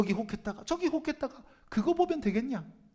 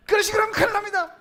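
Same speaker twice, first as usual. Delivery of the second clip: high, loud, fast pretend outburst; mock angry scolding; 10 semitones higher, 7 dB louder, faster than usual. Preacher pretending to scold his congregation, mock anger. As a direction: suddenly yell at the congregation as if furious. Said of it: "화를 냈다 칩시다".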